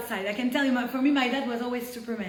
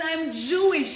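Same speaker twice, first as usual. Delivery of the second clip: mock scream; 5 semitones higher, 4 dB louder, slower than usual. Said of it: "The guy screams".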